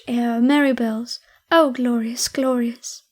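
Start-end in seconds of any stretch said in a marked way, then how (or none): none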